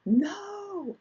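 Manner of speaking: high, raw cry of denial; anguished scream. Retold or imitated. Husband hearing daughter was shot, high anguished cry. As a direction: cry out no in shock. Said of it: "him scream at the top of his lungs".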